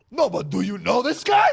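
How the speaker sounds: loud, high dad calling out to strangers; bragging dad. Retold or imitated. Dad asking guys at the tire place, loud proud boast. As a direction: imitate your dad loudly showing off his son. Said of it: "And my dad goes".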